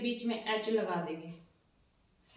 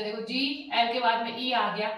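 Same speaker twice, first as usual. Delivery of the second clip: ordinary delivery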